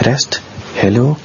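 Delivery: ordinary talk, nothing marked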